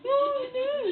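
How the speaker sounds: high mock lament of dismay; horrified mother. Mother appalled by her daughter's joke, horrified. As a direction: pitch the dismay way up.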